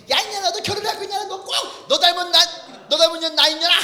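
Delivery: aunt cursing her daughter about marriage; scolding aunt. Retold or imitated. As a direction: rail at her like a fed-up aunt. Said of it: "이모가 꼭 마지막 이런 얘기 하거든요".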